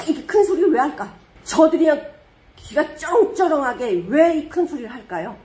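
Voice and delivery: ordinary delivery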